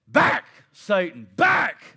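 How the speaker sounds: loud, punched-out command to the devil; commanding rebuke. Acted. Believer orders Satan away, commanding rebuke. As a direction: drive each word out hard.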